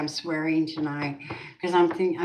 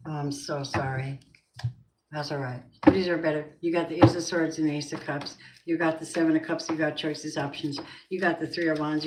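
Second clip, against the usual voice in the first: ordinary talk, nothing marked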